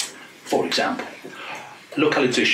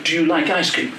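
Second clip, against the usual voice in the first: normal talking voice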